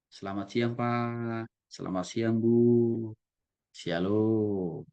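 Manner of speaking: gentle, low and drawn-out; soft, low greeting. Shown as a demonstration. Greeting elders politely, soft and low. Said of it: "pakailah intonasi yang lebih lembut, rendah".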